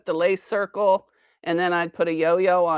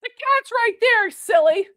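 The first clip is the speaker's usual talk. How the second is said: high pet voice; 3 dB louder than usual. Real, not acted. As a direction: coo it at the pet. Talking sweetly to pet.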